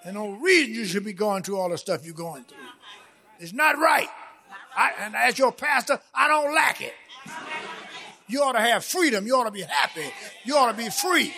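full-force pulpit delivery; impassioned preaching. Real, not acted. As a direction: drive each phrase home to the congregation.

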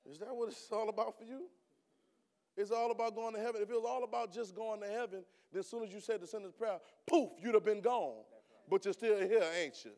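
loud, high shouted preaching; fiery preaching. Acted. Preacher tells the church how his father's call stops everything, preaching at a loud, high pitch. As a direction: preach it at full force to the room.

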